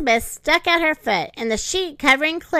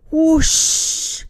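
voiced whoosh of wind; 3 semitones higher than usual. A narrator's reading. Narrator makes the wind blow harder, mock whoosh. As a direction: sound the wind blowing harder.

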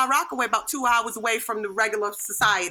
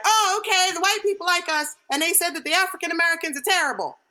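higher-pitched, readily agreeing parents; imitating credulous parents; 5 semitones higher than usual. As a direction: pitch it up, naively accepting. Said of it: "And then my parents are like".

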